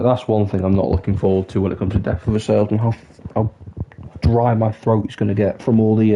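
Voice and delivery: normal talking voice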